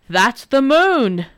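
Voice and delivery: slow, loud angry roar. Acted. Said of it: "roared the devil".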